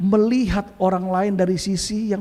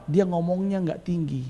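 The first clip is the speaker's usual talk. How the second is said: softer, unraised voice; nada rendah; 3 semitones lower, 7 dB quieter than usual. Shown as a demonstration. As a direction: keep the pitch down, speak softly. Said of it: "Nadanya itu bisa rendah". (nada rendah dan pelan)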